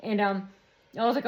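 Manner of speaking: ordinary delivery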